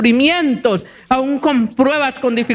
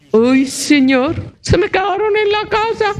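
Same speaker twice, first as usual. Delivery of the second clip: sheepish excuse; 7 semitones higher than usual. Retold or imitated. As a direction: play the caught-out man, squirming. Man answers the preacher, quoted.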